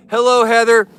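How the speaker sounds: big, high, loud hello; loud greeting. Real, not acted. Streamer shouting hello to a viewer's girlfriend, loud and high. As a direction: call the greeting out with full energy.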